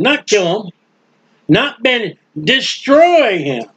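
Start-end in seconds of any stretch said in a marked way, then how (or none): 2.4-3.6 destroy stretched slowly and hammered, falling tune; stress on destroy. Shown as a demonstration. Preacher driving home the word destroy, slow and heavy. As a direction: lean the whole weight on destroy. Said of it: "Get that strong and underline that word, destroy".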